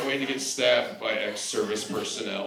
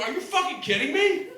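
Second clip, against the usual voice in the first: outraged rant; 10 semitones higher than usual. Retold or imitated. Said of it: "I'm like".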